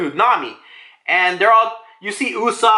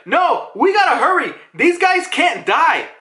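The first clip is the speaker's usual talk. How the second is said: urgent warning; 12 semitones higher than usual. Retold or imitated. Acting out urgent warning to hurry.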